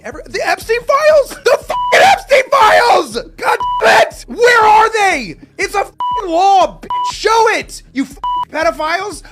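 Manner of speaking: outraged rant about the Epstein files; furious shout. Real, not acted. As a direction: rage at them, demand it.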